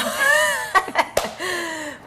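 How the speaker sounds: drawn-out laugh falling away; long laugh. Real, not acted. Guest laughing at the host's softball joke. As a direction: laugh along, then settle.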